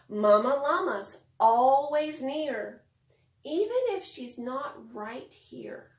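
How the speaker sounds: expressive picture-book reading; reading to children. Acted. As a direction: read it like bedtime story time for little kids.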